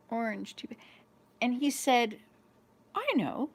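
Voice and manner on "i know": imitating a mild, higher-pitched helpful suggestion; calm, matter-of-fact. Son answering mother's question, calmly matter-of-fact. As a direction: offer it simply, a little higher. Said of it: "He said it so calmly and matter-of-factly".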